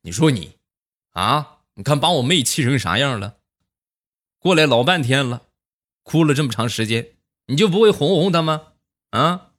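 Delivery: imitating an indignant uncle, pitch climbing; mock angry. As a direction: scold the brother-in-law with mild, rising exasperation. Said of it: "很生气啊".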